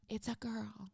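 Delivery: soft, hushed, barely voiced; retold whisper. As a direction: tell the news under your breath, as if others must not hear. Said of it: "They're kinda whispering".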